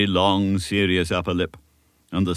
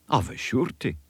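lightly voiced elderly Scottish minister, earnest; a kindly country minister giving counsel; old Scots minister; 5 semitones higher than usual. Minister advising young Davy, a light Scots earnestness.

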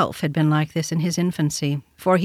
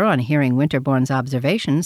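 ordinary delivery, nothing marked

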